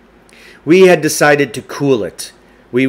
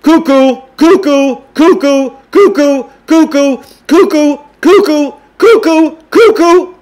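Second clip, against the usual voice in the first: cuckoo calls, high and louder, climbing higher with each one; cuckoo clock calls; 14 semitones higher, 7 dB louder than usual. Acted. Principal on the PA giving the time as cuckoo calls, climbing higher. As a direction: chime like a cuckoo clock going up and up. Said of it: "Mister Winter was cuckooing".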